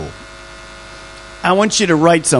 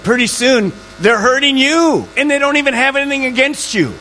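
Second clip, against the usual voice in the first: bitter people mocked; 9 semitones higher than usual. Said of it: "mocking the bitter, pitched up".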